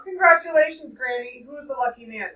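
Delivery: put-on boy congratulating granny; knowing smile. A narrator's reading. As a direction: congratulate her with a grin. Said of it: "I said with an understanding smile".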